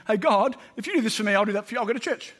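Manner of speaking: a fast, hammed-up deal offered upward; mock wheedling prayer. A preacher mimics a sly believer bargaining with God, quick and wheedling.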